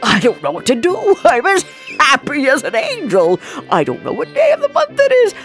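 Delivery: high, loud, elated babble; giddy joy. A narrator's reading. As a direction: bubble over with giddy delight. Scrooge giddy with joy on waking.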